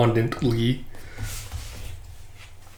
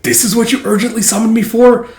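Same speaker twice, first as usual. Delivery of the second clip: high annoyed voice; 9 semitones higher, 4 dB louder than usual. Retold or imitated. Annoyed character.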